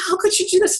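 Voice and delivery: retelling her own hurt outrage at him; upset. Retold or imitated. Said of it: "I was really upset".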